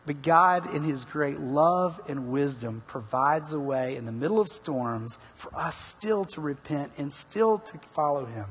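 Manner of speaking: usual speaking voice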